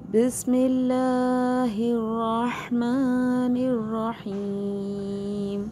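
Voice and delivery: slow chanted basmala; Quranic recitation. Shown as a demonstration. Teacher recites the basmala before the lesson, slow and chanted. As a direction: draw each syllable out in recitation.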